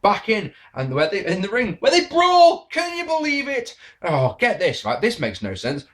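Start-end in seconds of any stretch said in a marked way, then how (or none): 1.8-3.7 much higher, louder sarcastic yell; sarcastic excited climax; mock hype shout. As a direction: shout it like a hyped announcer, mockingly. Mock hype over repetitive match.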